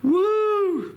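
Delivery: long, high, loud "woo"; excited whoop. Real, not acted. Cheering on a friend over his pepper, excited whoop. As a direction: let out a sustained high cheer.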